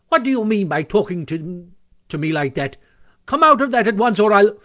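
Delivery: rising to a high, loud furious shout, cut off; angry Toad. A narrator's reading. Toad orders the ferret out, furious shout. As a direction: bluster until the threat breaks off. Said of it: "said Toad very angrily".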